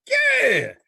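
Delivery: exaggerated high squeaky yeah; hammed-up high yeah; mock yeah. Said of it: "I'm like".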